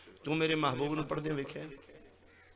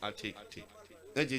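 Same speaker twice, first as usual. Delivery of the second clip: ordinary talk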